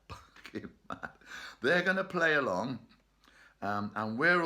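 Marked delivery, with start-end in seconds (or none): 0.1-1.1 breathy giggling aside; laughing whisper. Real, not acted. Laughing repeat of barking mad.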